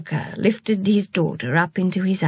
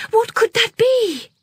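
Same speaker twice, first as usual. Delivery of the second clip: put-on high, alarmed little girl's cry; frightened cry; 4 dB louder than usual. Girl startled by a crash, crying out high in fear. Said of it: "Amrita cried out".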